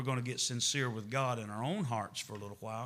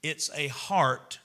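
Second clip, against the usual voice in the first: much higher and louder, urgent; preaching at full force; 5 semitones higher, 7 dB louder than usual. Acted. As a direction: drive it home to the church.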